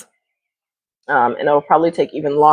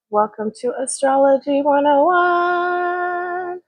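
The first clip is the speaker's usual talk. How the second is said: announcer voice; 12 semitones higher, 4 dB quieter, slower than usual. Acted. Opening announcement of the class.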